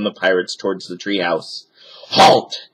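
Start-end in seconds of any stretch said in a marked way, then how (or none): 2.1-2.7 loud, higher command; captain shouting. A narrator's reading. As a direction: bark the order to stop. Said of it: "Captain Bones shouted".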